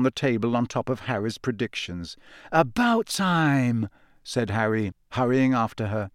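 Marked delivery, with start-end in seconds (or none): 2.5-3.9 relieved. Acted. Relieved greeting to owl.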